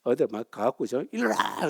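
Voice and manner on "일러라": high mock challenge, not at full volume; held-back Zen cry. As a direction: imitate a monk's drawn-out demand. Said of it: "이러고, 그죠?".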